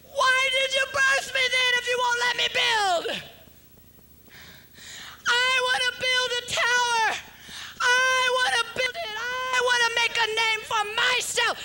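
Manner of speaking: a retold scream into the carpet; crying out. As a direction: wail it out to God in protest. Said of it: "I screamed into the carpet".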